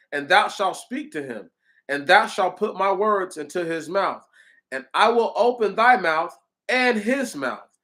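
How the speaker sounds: emphatic, measured Bible recitation; scripture reading. Acted. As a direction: read out the verses as solemn proclamation. Continues reading scripture aloud.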